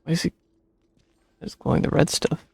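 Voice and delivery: ordinary delivery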